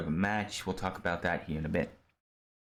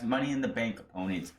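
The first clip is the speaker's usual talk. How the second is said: ordinary talk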